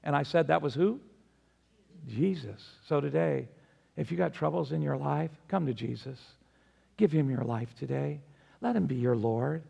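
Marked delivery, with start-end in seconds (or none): 2.1-9.6 low, soft, earnest invitation; gentle appeal. Real, not acted. Preacher inviting troubled listeners to come to Jesus, gentle appeal. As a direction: drop low and quiet to invite them tenderly.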